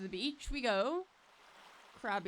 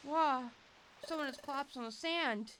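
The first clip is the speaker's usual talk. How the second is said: lightly voiced, higher, concerned partner Pokemon; worried young Riley; 6 semitones higher than usual. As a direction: check on the stranger with rising worry. Voices Riley finding collapsed stranger.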